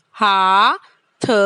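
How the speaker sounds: हाथ stretched very slowly; slow drill. Shown as a demonstration. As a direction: drag out हाथ. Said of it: "उच्चारण करना है इसका बार-बार".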